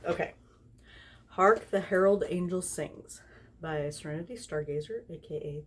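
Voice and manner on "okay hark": usual speaking voice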